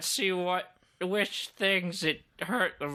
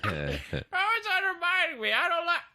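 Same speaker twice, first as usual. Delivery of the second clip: hammed-up grievance, pitched way up; whining Saul; 12 semitones higher than usual. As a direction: wail the complaint in a high voice. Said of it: "you can hear Saul being like".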